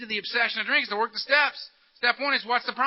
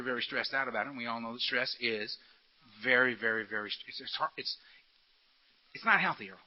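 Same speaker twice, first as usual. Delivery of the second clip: low, soft, sympathetic tempter; coaxing beast, soothing; 7 semitones lower, 7 dB quieter than usual. Acted. The beast commiserating with stressed-out Earl, softly coaxing. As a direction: soothe and flatter, keeping it low and quiet.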